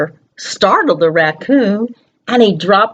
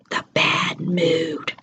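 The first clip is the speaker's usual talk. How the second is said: slow, hushed whisper; spooky whisper; 3 semitones lower, 9 dB quieter, much slower than usual. Acted. Storyteller hushes children to reveal the bad mood. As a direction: breathe it out like a secret.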